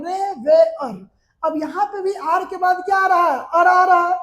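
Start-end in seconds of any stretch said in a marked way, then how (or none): 0.0-1.1 रेवेयर drawn out slowly, syllable by syllable: re-ve-yar; careful pronunciation. Shown as a demonstration. Teacher slowly models रेवेयर for shorthand students. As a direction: spell out रेवेयर slowly so students catch each sound. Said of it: "ध्यान दीजिए यहाँ पे उच्चारण".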